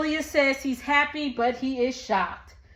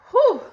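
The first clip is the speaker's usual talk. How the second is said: loud whew; 10 semitones higher, 7 dB louder than usual. Real, not acted.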